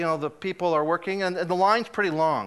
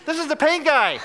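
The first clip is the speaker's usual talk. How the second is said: high, loud mock announcement; calling out; 9 semitones higher, 4 dB louder than usual. Employee pointing out the customer to coworkers, calling out. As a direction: sing out the news to everyone, voice way up. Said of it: "calling her other employees".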